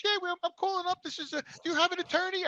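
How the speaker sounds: lawyer's caller, high and soft; lady caller. Retold or imitated. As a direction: pitch up for the woman on the line. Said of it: "She goes".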